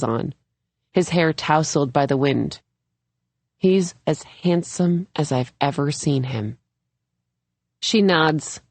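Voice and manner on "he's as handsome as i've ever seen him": usual speaking voice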